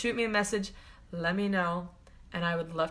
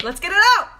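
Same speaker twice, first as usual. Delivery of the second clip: much higher and louder push; fired up; 11 semitones higher, 13 dB louder, much faster than usual. Real, not acted. Urging viewers to release what's stuck, fired up. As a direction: burst it out like a rally cry.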